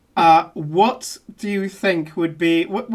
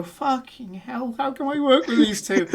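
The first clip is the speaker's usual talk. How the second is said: exasperated aside; 6 semitones higher than usual. Retold or imitated. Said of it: "as if to go".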